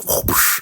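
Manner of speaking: voiceless whooshing swipe noise; airy swish of a flying slap; imitated slap whoosh. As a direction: make a slap sound effect with the breath. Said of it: "she goes".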